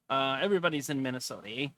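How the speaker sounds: a hint of a Minnesota accent, leaning on the eh; playful Minnesota accent with a tag eh. Host jokes about Minnesota, mild Minnesota accent. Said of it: "So now I gotta talk like this".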